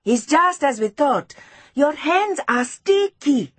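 put-on high, triumphant child's call; mildly shouted, high-pitched gloating; shouted accusation. A narrator's reading. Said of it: "she shouted".

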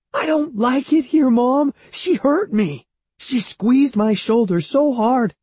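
put-on very high child's complaint; wailing. A narrator's reading. Boy complaining his aunt hurt him, wailing. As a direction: pour out hurt in a high whine. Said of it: "Evan wailed".

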